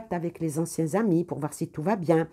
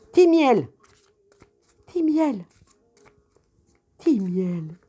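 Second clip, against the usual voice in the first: calling out; 6 semitones higher, 6 dB louder, slower than usual. Real, not acted. Calling the cat.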